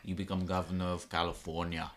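put-on light Austrian accent, action-hero style; a hint of an Austrian strongman accent; Arnold Schwarzenegger accent. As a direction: drop into a passable Austrian accent for the punchline. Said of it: "That was actually a decent accent".

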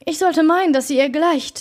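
a touch of surly protest; grumpy. A narrator's reading. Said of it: "versetzte der Diener mürrisch".

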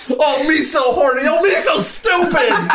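high, loud sing-song mimicry through laughter; hammed-up mockery. Podcaster quotes the film's taunt, mocking.

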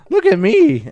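very high, loud cartoon cry; Meeseeks voice. Joining in the Meeseeks catchphrases with a friend, high loud squeal. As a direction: squeal it like an eager cartoon creature.

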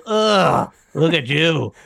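a sneering, exaggerated scoff dragged out slowly; mocking disgusted tone. Cohost mocking the disgusted tone he's accused of, in a sneering scoff. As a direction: scoff like the raisins are beneath you. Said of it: "I didn't say it in that tone".

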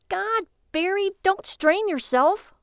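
high, slow, drawn-out sarcasm; mocking exasperation. Wife mocking husband's slow thinking, sarcastic. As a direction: needle him, stretching every word.

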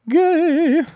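squeaky high mock jeer. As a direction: sneer it like a teasing kid. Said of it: "everyone's gonna think".

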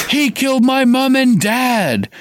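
put-on boy roaring at his parents' murderer. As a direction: scream it at your parents' killer. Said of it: "Harry roared".

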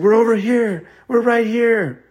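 high, loud answering call. Acted. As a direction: shout so they find you. Calling back to searchers.

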